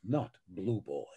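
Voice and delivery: a hint of indignant Beast, slow and measured; lightly voiced Beast, slow and offended. Quoting Beast's favourite line, mildly indignant and slow. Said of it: "he gets very indignant".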